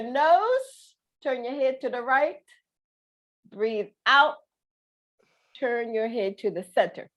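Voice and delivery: unhurried coaching voice; calm breathing guide. Shown as a demonstration. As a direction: lead the stretch calmly.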